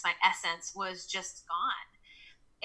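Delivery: slow, hushed whisper; a slow whisper of loss. Real, not acted. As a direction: let it fade to a breathy whisper. Describing losing herself in mirror.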